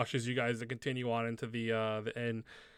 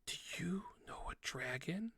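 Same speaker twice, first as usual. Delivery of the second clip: high, hushed gasp of wonder; little kid's wonderstruck whisper; awed child whisper; 6 semitones higher, 11 dB quieter than usual. Acted. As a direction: whisper it breathlessly like an amazed kid. Child asks the sorceress in awe.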